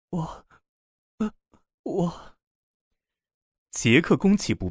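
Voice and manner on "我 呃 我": small, high, choked-off syllables; halting stammer. A narrator's reading. Lina, too flustered to refuse Jack, stammers haltingly. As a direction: stammer softly, words stuck in the throat. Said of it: "只能发出断断续续的".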